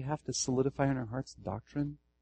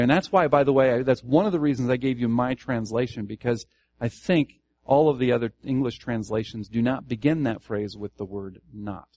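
usual speaking voice

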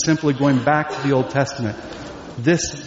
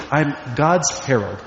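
ordinary talk, nothing marked

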